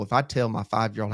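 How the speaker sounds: normal talking voice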